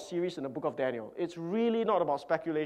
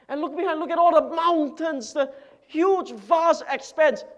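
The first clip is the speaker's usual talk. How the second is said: loud, high bragging over his land; a proud landowner crowing over his wealth; boastful rich man; 11 semitones higher, 7 dB louder than usual. Acted. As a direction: show off every field you own. Boaster shows mountains.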